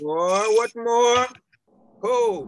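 startled rising cry; panicked questions; alarmed. Acted. Alarmed at more visions.

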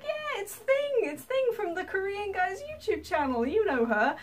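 thrilled, high-pitched fan; excited introduction. Retold or imitated. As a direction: show her off to the family. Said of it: "and he's like".

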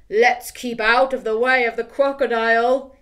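loud booming voice; big skeleton. Acted. Big skeleton warns of crocodile, loud. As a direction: warn them loudly.

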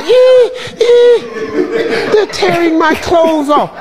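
high squeaky whimper, laughing; mock whine. Mocking Mike's complaint about fans, high laughing whine. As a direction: whine it high like a scared kid.

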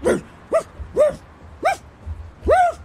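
high-pitched yapping woofs; dog barking. Acted. Showing a viewer how to play a dog, high barks. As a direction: imitate a small yappy dog. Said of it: "as a dog".

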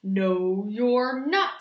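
lightly voiced troll, firm refusal. A narrator's reading. Troll refusing the little goat, mildly gruff. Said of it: "said the troll".

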